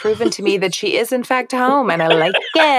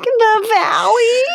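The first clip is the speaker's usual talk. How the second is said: put-on high, loud whiny Valley Girl; Valley Girl whine; 4 dB louder than usual. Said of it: "She's like".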